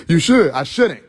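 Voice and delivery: ordinary talk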